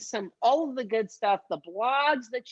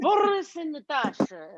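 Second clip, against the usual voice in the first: raised, hammed-up cartoon Russian accent; 4 semitones higher, 4 dB louder than usual. Host explains failed clue to guest, cartoon Russian accent. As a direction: roll it out in a mock Russian accent. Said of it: "I'm Russian".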